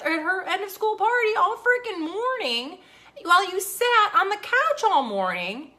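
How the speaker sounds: high, loud furious rant. Real, not acted. Mom ranting about husband who forgot their son, furious. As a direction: yell it out, fed up.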